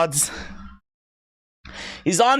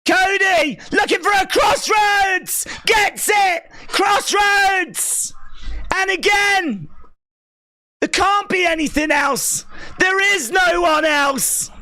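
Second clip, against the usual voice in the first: excited shouting; 8 semitones higher than usual. Real, not acted. Streamer shouting the finish to viewers, thrilled. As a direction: shout the action as it happens, thrilled. Live reaction shouting match calls.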